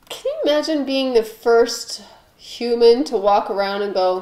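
normal talking voice

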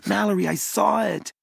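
desperate to be believed; urgent insistence. Acted. Boy insisting to his doubting sister, urgent and high.

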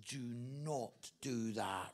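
low, very slow, measured warning; wife's warning. Retold or imitated. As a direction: mildly imitate a wife spelling out each word. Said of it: "and she says".

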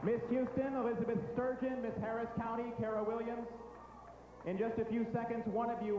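dramatic announcer building suspense; pageant host. Acted. Announces runners-up.

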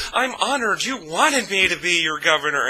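high, mocking singsong of fake honor; Phelan Palin. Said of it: "So Palin just goes".

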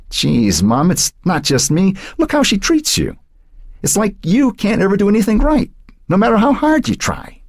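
put-on high, exasperated boy's voice; indignant teen son. A narrator's reading. Son protesting how grandma treats his mother, exasperated.

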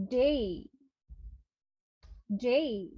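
ডে said as day, with the e gliding into i; correct pronunciation. Shown as a demonstration. Teacher models how to pronounce day. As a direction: stretch ডে into a clear day glide. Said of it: "এটার উচ্চারণ exactly হবে".

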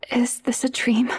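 whispered in disbelief, barely voiced; too scared to speak aloud; frightened whisper. [name], frozen by the stranger, whispering in fear.

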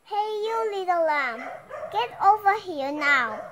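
loud shout, rising to a yell on get over here now. Acted. Wolf shouting at the lamb by the river. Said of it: "he shouted".

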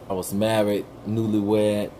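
normal talking voice

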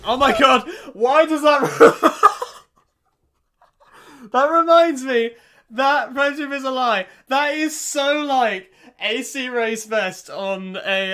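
loud, high outburst through laughter; laughing hard. Real, not acted. Reactor reminded of a silly comparison, bursting with loud laughter. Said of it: "that just got me laughing".

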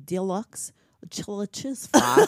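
put-on slow, careful French-accented take on "Deluxe chili cheese fry". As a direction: struggle through the words like a French student. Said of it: "this is how I hear it".

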